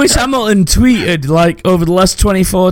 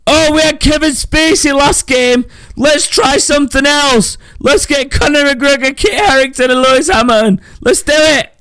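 mock studio executive; 8 semitones higher than usual. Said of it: "It was like".

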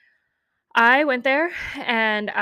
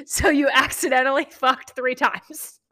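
slow, higher, louder mocking question; laughing incredulity; 4 semitones higher, 5 dB louder than usual. Woman retelling confronting her cheating boyfriend, amused incredulity. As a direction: drag it out, incredulous, near laughter.

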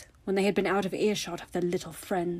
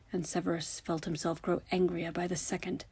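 ordinary delivery

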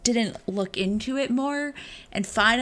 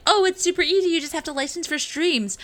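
mock breezy pitch; 7 semitones higher, faster than usual. Mimicking Microsoft saying streams are super easy, mock enthusiasm. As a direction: imitate a vendor making it sound effortless.

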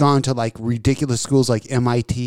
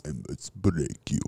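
much lower, much softer Russian menace; Ivan Drago impression; 11 semitones lower, 13 dB quieter than usual. Drago impression for a friend, low Russian menace. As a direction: growl it like Drago in the ring. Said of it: "You're not Ivan Drago".

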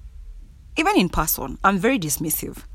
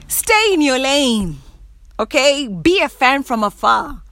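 loud, raised-pitch telling-off; emphatic warning; 5 semitones higher, 3 dB louder than usual. Acted. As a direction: warn them off, punching each phrase. Acted warning to nosy acquaintance.

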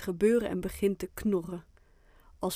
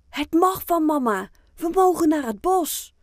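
excited, raised and louder storytelling voice; enthusiastic call; 8 semitones higher, 9 dB louder than usual. A narrator's reading. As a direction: call out great news to a friend. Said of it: "roept hij enthousiast naar Fien". (enthousiast geroepen)

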